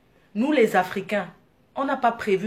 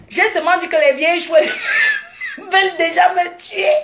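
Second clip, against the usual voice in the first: laughing retelling; 7 semitones higher, 5 dB louder than usual. Retold or imitated. Retelling her panic on plane.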